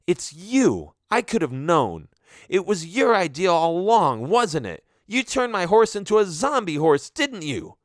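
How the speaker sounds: high, loud accusing voice; angry accusation. Acted. Diana confronting Daphne about her cursed horse, angry and high. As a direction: throw the blame right at her.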